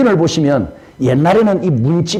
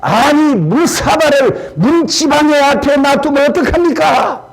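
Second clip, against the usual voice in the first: angry talking back; 8 semitones higher than usual. Retold or imitated. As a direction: snap back at the father in outrage. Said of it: "화가 나서 대꾸를 하죠".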